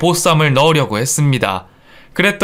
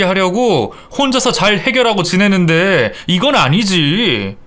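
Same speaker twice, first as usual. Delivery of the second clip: higher, insistent complaint; aggrieved husband; 4 semitones higher than usual. Acted. Son arguing with his wife about meals, aggrieved. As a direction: argue your case, aggrieved.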